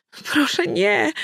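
high, frightened begging; pleading cry. A narrator's reading. Danuta begging the narrator not to bring Badb near, in a high frightened plea. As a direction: beg her to stop, voice jumping up. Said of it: "zawołała błagalnie Danuta".

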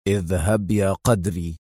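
slow, low, stern order. A narrator's reading. Adham ordering Qadri to leave, sternly. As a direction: send him off firmly, low and measured. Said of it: "وقال لقدري في صرامة".

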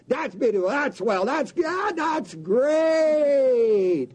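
rising cheer into a shout; the crowd cheers the odds; mock excited crowd. Crowd cheering the contest, rising to a shout.

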